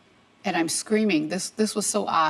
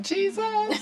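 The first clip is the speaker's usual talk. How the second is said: much higher anguished cry of the name; a retold plea, pitched far up; despairing cry. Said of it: "I fell on the floor like".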